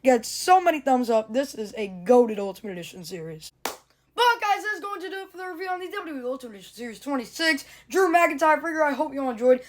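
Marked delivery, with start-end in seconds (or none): 4.1-9.6 YouTuber outro. Acted. Channel outro.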